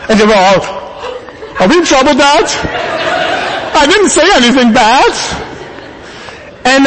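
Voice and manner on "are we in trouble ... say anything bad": put-on high, anxious little-kid voice; worried child. Kids asking Dad if they are in trouble, worried. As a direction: imitate a child fearing a telling-off.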